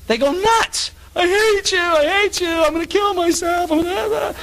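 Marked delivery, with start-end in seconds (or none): 1.1-1.8 high loud hate; mock teen outburst. Repeated tantrum line. 1.8-4.3 high, loud mock wail of a teen; mock teen tantrum. Preacher mimicking a teen screaming at parents, high and loud.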